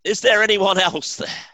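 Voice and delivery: high, loud call up the cliff; hopeful shout. A narrator's reading. Man shouts up the cliff looking for other help, loud. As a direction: yell upward, punchline. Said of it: "and said".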